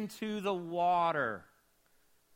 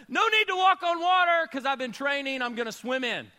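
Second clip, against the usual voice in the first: eager Peter; 7 semitones higher, 7 dB louder than usual. Acted. Peter voiced, jumping in.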